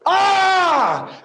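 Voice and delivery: very high loud shrieked ah; demon's shriek. Shown as a demonstration. Pastor acts the demon's shriek to his congregation. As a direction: shriek it out in terror. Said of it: "This is a scream".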